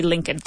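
Lincoln as a low LINK-un; silent L. Shown as a demonstration. Teacher settling the point, Lincoln as LINK-un. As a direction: say "Lincoln" without its second L. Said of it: "we don't say that L".

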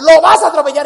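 sudden loud repeat; mock yell. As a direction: blast the repeat as mom panics. Mom's road rage retold.

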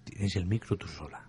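soft whisper, barely voiced. Real, not acted. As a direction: whisper so the caller can't hear. Susurran junto al micro abierto.

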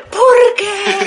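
very high, hammed-up lament; mock wailing. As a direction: imitate a heartbroken drunk bawling. Imita el llanto del amigo. (lamento agudo, llorón y exagerado)

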